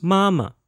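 mama high and level, then dropping light; first plus neutral tone. Shown as a demonstration. Teacher models mama for repetition, first then neutral tone. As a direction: hold the first syllable of mama high, let the second fall. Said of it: "M A with a first tone".

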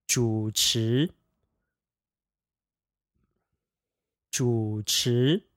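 主持 said zhǔ chí, low dip then rising; 主持 with third tone then second tone; practicing tones. Shown as a demonstration. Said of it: "third tone, second tone pair".

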